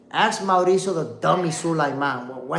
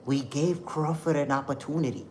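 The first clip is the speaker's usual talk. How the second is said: softer, mocking imitation of the boss's excuse; 2 semitones lower, 7 dB quieter than usual. Host mocking the boxing boss's excuse, a softer sneering imitation. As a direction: mimic his smug framing with scorn. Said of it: "I love the way that he framed it".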